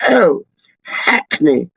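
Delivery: slow, drawn-out mock posh accent. East Londoner mocks posh speakers saying Hackney, posh accent. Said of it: "Posh Peach would be like".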